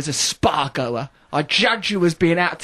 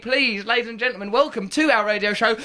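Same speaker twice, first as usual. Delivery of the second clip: mock-solemn build to the guest's name; hammed-up ceremonial introduction; grand announcement; 4 semitones higher than usual.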